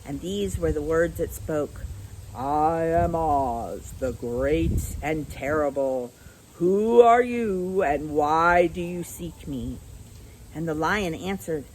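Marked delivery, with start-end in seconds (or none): 2.3-9.8 slow, lower, drawn-out voice of the great wizard; low quiet voice. A narrator's reading. Oz booms slowly at the cowardly Lion, slow and lower. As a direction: speak slowly as a mighty, hidden ruler. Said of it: "Then a low, quiet voice came from the ball of fire".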